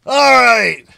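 loud, high, excited yells; pumped, over the top; real exhilaration; hyped shout. Real, not acted. Hyped reacting to the metal.

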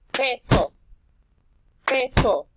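"Peso" split loud into PE-SO; clapped syllables. Shown as a demonstration. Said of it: "repetir los pasos para la palabra peso". ("Peso" separado en sílabas con palmadas)

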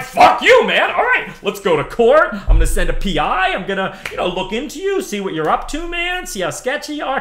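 high, loud defiant yell that eases off toward the end; furious yell. Retold or imitated. Defying the patent troll who sued, loud angry yell. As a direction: hurl it at the patent troll, then settle down. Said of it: "I'm like".

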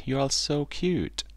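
high, slow cooing delight; joyful. A narrator's reading. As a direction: gush over the babies, drawn out. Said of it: "said Mama Duck with joy".

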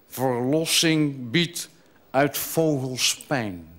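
solemn verse delivery; poetry recitation. Acted. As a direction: recite it line by line with pauses. Recites a longer bird poem.

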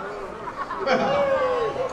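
short high-pitched laugh. Real, not acted. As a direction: burst out laughing. Laughs after crude announcement.

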